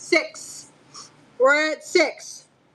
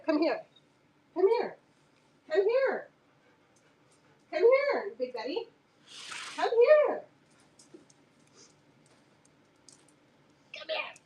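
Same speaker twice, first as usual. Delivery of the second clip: calls to the dog climbing higher each time; sweet pet-calling voice, going higher and higher; coaxing dog call; 7 semitones higher than usual. Real, not acted. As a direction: coax the dog over in a rising singsong. Coaxing dog to come over.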